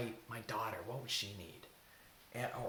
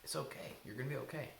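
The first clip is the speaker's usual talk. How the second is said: softer, calming self-comfort; gentle, quiet reassurance; soothing voice; 8 dB quieter than usual. Shown as a demonstration. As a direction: reassure yourself with a hand on your heart. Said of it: "speak love to yourself".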